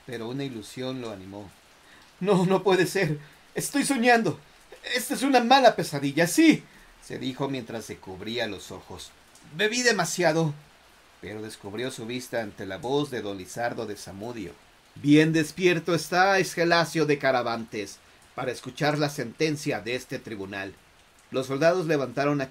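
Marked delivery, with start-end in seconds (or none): 2.2-6.6 high, rushing frantic self-talk; panicked denial. Acted. Man panics, tells himself dreaming. 9.5-10.5 desperate. Acted. Man blames drink. 14.9-20.7 stern judge. Acted. Dead judge addresses accused.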